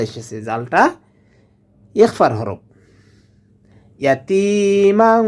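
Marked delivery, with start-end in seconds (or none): 4.0-5.2 "ইয়াতিমাং" chanted higher with long i, nasal ending; ghunnah. Shown as a demonstration. Teacher showing ghunnah, held nasal hum ending "ইয়াতিমাং". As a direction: stretch the i and hum the end of "ইয়াতিমাং". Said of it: "মাং এখানে গুন্না করতে হবে".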